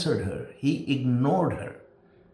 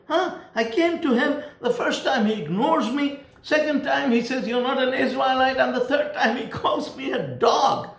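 high-pitched mock indignation; offended; 9 semitones higher than usual. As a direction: act out an affronted woman, pitch raised well up. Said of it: "she should have been offended and say".

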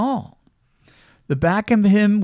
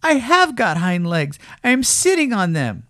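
indignant little rabbit, pitched up; insistent higher rabbit voice; crying out; 8 semitones higher than usual. A narrator's reading. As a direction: protest as a small defensive rabbit. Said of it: "cried the little rabbit".

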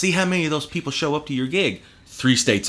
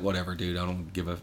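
low, soft, couldn't-care-less; a hint of a bored slacker; indifferent shrug; 7 semitones lower, 8 dB quieter than usual. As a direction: toss it off like it doesn't matter. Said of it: "And Nick's just like".

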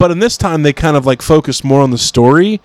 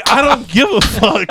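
mock shout; 9 semitones higher than usual. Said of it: "I'm like".

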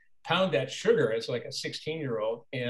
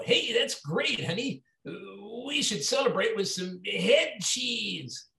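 much higher, slow, unhinged character voice; creepy hitchhiker voice; 8 semitones higher, slower than usual. Retold or imitated. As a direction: play the deranged husband sweetly. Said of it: "I go".

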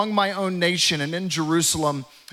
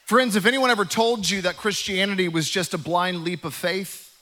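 ordinary talk

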